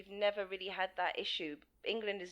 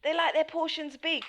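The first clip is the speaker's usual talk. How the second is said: higher-pitched mocking Brit, laughing through it; mock British grumbler; 11 semitones higher, 9 dB louder than usual. Mocking how British people moan about Americans, higher, laughing. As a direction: parody a grumbling average Brit. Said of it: "They always go".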